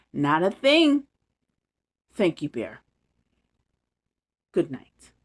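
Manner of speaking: put-on lively bedtime story read to kids; story narration. A narrator's reading. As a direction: read it like a storybook for little ones. Reads bedtime story aloud.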